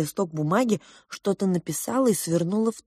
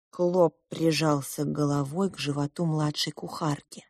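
ordinary delivery

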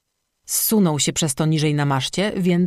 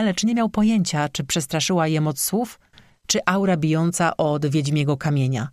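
usual speaking voice

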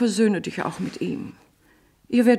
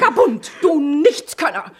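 high, loud scornful jeer; insulting shout; 5 semitones higher, 7 dB louder than usual. Acted. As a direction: fling the insults at him. Mother hurls insults.

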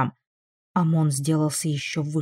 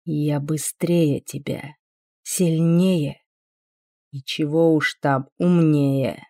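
cold, slow, low demon; icy voice; 3 semitones lower, slower than usual. A narrator's reading. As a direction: lay it out with icy calm. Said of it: "ледяным голосом сообщил Амон".

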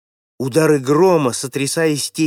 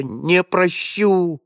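high, slow, crying lament rising to a shriek; old man cries out to God in anguish before the boy; anguished wailing; 10 semitones higher, slower than usual. A narrator's reading. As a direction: wail it out as a broken old man begging God. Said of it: "Голос деда поднялся до пронзительного визга".

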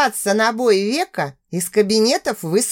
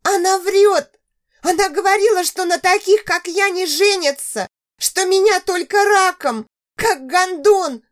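sobbing; 9 semitones higher than usual. Acted. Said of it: "Хлюпая носом".

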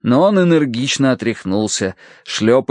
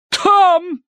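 high, drawn cry of alarm; a matron's dramatic, high exclamation; theatrical outcry. Acted. Aunt pointing out the dog, theatrical outcry.

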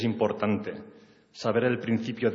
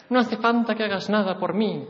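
much higher, a woman's voice put on; her high voice; 11 semitones higher than usual. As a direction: lift into her voice. She answers, voiced.

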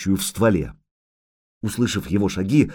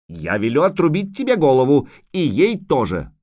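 put-on higher, haughty childish threat; indignant yelling child; 4 semitones higher than usual. A narrator's reading. Little princess threatens witcher and dryad, haughtily indignant. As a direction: threaten like a small offended princess. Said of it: "хватит вопить".

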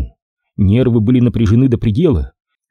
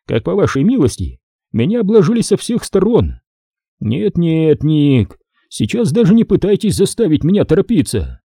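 higher-pitched voice of protest; indignant major; 6 semitones higher than usual. Acted. Major refusing to be hurried by Nick, indignant. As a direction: argue back, pitch riding high. Major continues his objection.

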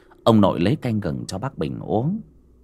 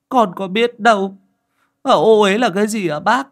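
put-on high, louder child's voice; puzzled boy; 12 semitones higher, 6 dB louder than usual. A narrator's reading. Boy asking his uncle what defilement means, high puzzled voice. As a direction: ask it as a confused little boy.

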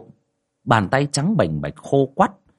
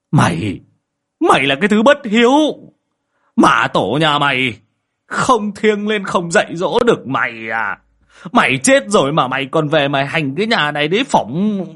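loud, raised-pitch angry tirade; furious cursing; 5 semitones higher, 4 dB louder than usual. Acted. Old man cursing his dead daughter at home, furious. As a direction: rail at someone who has disgraced the house.

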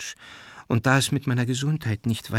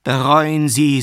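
higher, forceful call to repent; loud exhortation; 5 semitones higher, 8 dB louder, slower than usual. A narrator's reading. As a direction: urge repentance with a raised voice. Matvei exhorts cousin to repent. (laut mahnend)

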